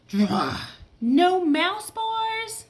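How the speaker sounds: high, loud, slow whine of disbelief; aggravated cat. Acted. Cat upset there are no mouse bars, loud slow whine. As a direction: stretch it out like a grumpy cat. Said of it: "The cat's aggravated".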